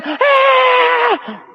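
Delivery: high, drawn-out mock crowd squeal. Whole audience reacting to the presenter, squealing. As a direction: imitate a screaming, thrilled audience. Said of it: "Y todos hacían".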